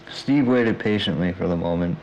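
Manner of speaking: usual speaking voice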